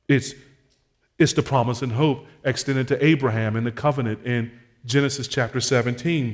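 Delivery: ordinary talk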